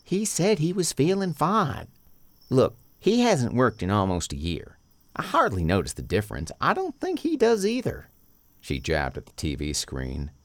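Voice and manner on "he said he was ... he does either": high, loud woman's voice; Sheena voice. A narrator's reading.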